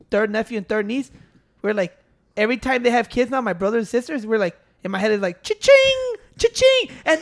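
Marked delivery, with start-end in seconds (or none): 5.4-6.9 high, loud sung "cha-ching"; cash register sound. Retold or imitated. Uncle thinking of new nieces as money, cash register chime. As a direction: ring it out like a till.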